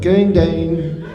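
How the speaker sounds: lightly imitated South African lift voice; a hint of a South African announcement voice; South African elevator voice. Comic mimicking the hotel elevator, light South African accent. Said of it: "That's exactly what she sounds like".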